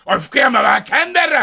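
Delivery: high mock shout at an actor; indignant heckle. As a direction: yell at the screen in exasperation.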